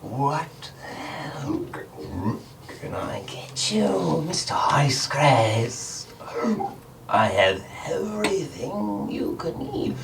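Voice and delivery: a mucus-clogged little creature hissing softly; raspy wet creature. Acted. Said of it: "soft voice, raspy and wet".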